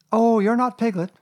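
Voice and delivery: put-on high, loud child's voice; boy Christopher Robin. A narrator's reading. Christopher Robin judging Piglet, high boyish certainty.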